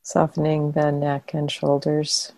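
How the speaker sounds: calm meditation guidance with long pauses between phrases; guided meditation. Real, not acted. Teacher guiding meditators to soften and relax the body, guided meditation. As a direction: guide them gently into relaxation, leaving long silences.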